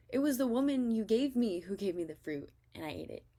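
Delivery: high, loud defensive Adam, pushing blame; a man caught out, loud and defensive. Acted. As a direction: shift the blame onto her, voice up and loud. Adam blaming the woman.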